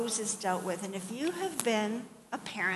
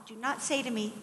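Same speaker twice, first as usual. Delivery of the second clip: ordinary delivery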